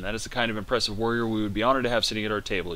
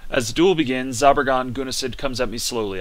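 ordinary talk, nothing marked

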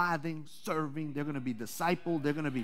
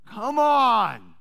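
preaching call; 4 semitones higher, 7 dB louder than usual. Real, not acted. Preacher calling out to congregation.

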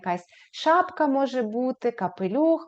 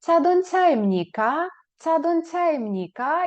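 Чадонцяємніка higher with a rising end; question rise; 5 semitones higher than usual. Shown as a demonstration. Teacher models the question, rising tune. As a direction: ask Чадонцяємніка, pitch going up. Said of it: "ви спитаєте".

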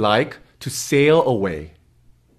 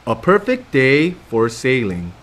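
put-on reading to children. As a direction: read it like bedtime for little ones. Reads picture book to kids.

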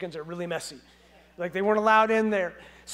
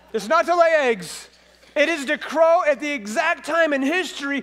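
louder, higher fervent voice of God; God speaking; 7 semitones higher, 7 dB louder than usual. Pretend. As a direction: declare it with divine certainty. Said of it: "God's like".

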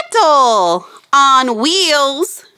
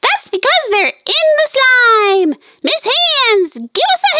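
high, fast cartoon puppet chatter; squeaky puppet voice; 12 semitones higher than usual. Fizzy the puppet cheering Phoebe, high cartoon voice. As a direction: chirp it like a tiny cartoon friend.

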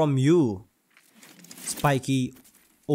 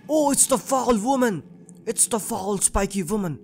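higher-pitched startled cry; 6 semitones higher than usual. Real, not acted. Gamer spots the spiky woman in the game, startled. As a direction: call out in alarm at a sudden sighting.

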